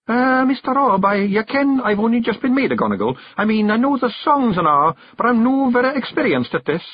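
a timid lad owning up he's new; young Gonigal Billy, nervous. A narrator's reading. Billy admits to Rob he is inexperienced, nervous high voice.

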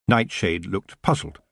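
plain reading voice

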